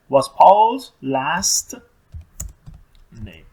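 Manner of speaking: very slow, loud start, fading at the end; slow dictation. Shown as a demonstration. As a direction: dictate it word by word. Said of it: "حرف بزرگ رو یادمون نره". (دیکته کردن آهسته و کلمه به کلمه)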